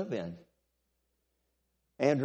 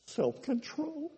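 high, slow, squeezed out unwillingly; reluctant word; 14 semitones higher, 6 dB quieter, slower than usual. Acted. Preacher confessing to the church, saying the word reluctantly, high and slow. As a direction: say it like it hurts to say. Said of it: "I hate to say this word out loud".

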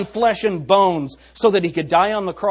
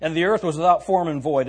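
ordinary delivery

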